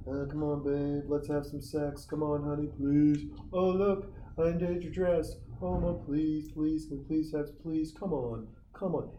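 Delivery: hammed-up whiny begging for sex; begging boyfriend. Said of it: "Where the guy's like".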